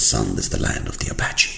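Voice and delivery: soft, low, reverent reveal; lightly voiced father showing the land; hushed and grave, a touch of ceremony; solemn father. Father presents land to son.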